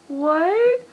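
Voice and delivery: high, startled yelp of disbelief; shocked what. Retold or imitated. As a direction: react like you can't believe it. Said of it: "and I'm like".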